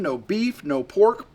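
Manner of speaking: ordinary delivery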